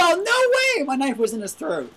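high loud yell easing into indignant complaint; outraged protest. Real, not acted. Gamer whose knife was in his throat, outraged yell. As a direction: cry out in loud disbelief.

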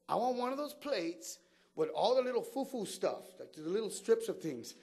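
high, loud showy ordering; acted eager order. Acting out his restaurant order.

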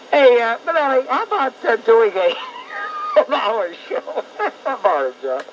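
amused shout to the producer; laughing disbelief. Real, not acted. Laughing incredulous call to producer.

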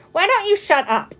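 put-on high, indignant little-girl comeback; cross retort. A narrator's reading. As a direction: snap back at the teasing. Needled little girl snaps back.

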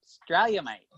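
playful Australian twang with a lilting swing; broad Aussie accent. Shown as a demonstration. Co-host showing the host how Aussies say it, Australian accent. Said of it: "Can you say that?".

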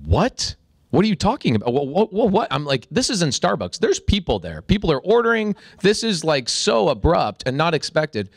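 fired out of nowhere in a busy café, reeling; shocked disbelief. Retold or imitated. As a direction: sputter it out like you can't believe your ears. Shocked at being fired in Starbucks.

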